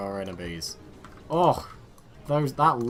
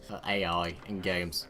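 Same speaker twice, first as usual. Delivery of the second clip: ordinary delivery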